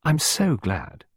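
playfully pleased, drawn out; cheerful. A narrator's reading. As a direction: answer his love with breezy delight. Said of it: "replied Clarissa cheerfully".

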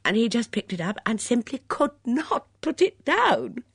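lively gushing chatter with swooping emphasis; chatty, eager guest. Acted. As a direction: rattle on eagerly, then catch yourself. Chatty character in the story.

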